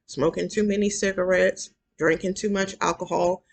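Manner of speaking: ordinary delivery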